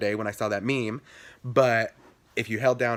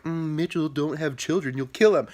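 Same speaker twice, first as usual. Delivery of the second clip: a hint of a higher, scolding voice; mock warning; 5 semitones higher than usual. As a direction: tease Mitchell, lightly voiced. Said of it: "people are like".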